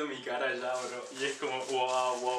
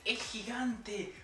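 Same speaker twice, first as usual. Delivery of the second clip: much higher, slow marvelling; amazed; 9 semitones higher, much slower than usual. Real, not acted. Holding the huge microphone, high marvelling delight. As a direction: squeal it up high, astonished.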